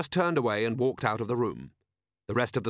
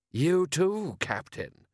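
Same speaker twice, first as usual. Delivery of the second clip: high, drawn-out lordly voice; a disdainful aristocrat's rising disbelief; haughty Lord Rust; 7 semitones higher, much slower than usual. Acted.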